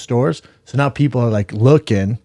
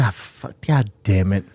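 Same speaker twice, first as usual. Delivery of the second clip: muttered curse; 5 semitones lower, 7 dB quieter than usual. Retold or imitated. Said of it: "he's like".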